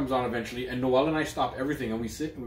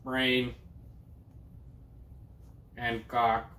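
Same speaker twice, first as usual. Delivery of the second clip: Stephen Hawking voice; 5 dB quieter, slower than usual. Pretend. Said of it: "This is my Stephen Hawking voice".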